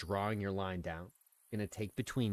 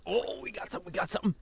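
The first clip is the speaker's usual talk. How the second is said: excited; 13 semitones higher, much faster than usual. Real, not acted. A fish bites mid-explanation.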